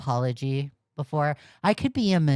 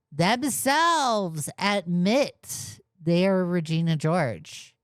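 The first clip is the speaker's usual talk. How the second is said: each word spelled out slowly; slow emphatic; 2 semitones higher, slower than usual. Real, not acted. Streamer mocking the apology, slow and emphatic. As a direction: drag out the admission for effect. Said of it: "they even admit themselves".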